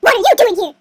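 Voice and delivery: loud startled demand; mock-outraged challenge; indignant beast. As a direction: burst out at an intruder.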